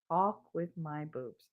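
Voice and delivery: soft, slow flat repeat; deadpan echo. Retold or imitated. Wife repeats husband's words back, deadpan and soft. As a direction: echo his words back, unimpressed and quiet.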